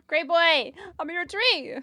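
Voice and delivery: high, loud praise for the cat; excited pet praise. Real, not acted. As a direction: cheer the cat up the tree.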